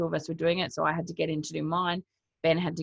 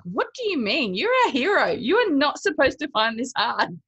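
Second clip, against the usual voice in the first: incredulous; 9 semitones higher, 5 dB louder than usual. Retold or imitated. Retold disbelief at the hero.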